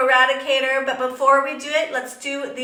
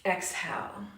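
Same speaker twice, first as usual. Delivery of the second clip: low, soft, slow yoga cueing; soft guided meditation; 3 semitones lower, 7 dB quieter than usual. Acted. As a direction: guide the breath calmly and quietly. Soft cueing of a yoga breath lock.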